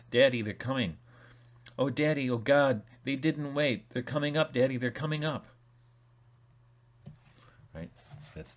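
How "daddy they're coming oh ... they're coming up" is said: put-on higher and louder, a scared boy pleading into the phone; a tearful plea for help, voice pitched up; frantic child. A boy begging his father on the phone, frantic and pitched up.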